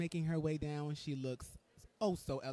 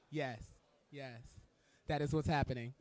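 normal talking voice

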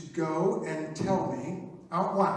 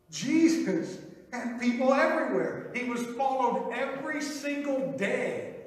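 raised preaching voice; preaching loudly; 6 semitones higher, 3 dB louder than usual. Real, not acted. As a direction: proclaim it to the crowd. Preaching build to climax.

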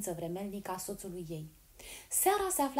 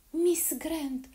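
high, loud glad cry of the name; overjoyed recognition; excited exclamation; 5 semitones higher, 7 dB louder, much slower than usual. Acted. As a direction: call out the name in delighted surprise. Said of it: "exclamă Lady Helena".

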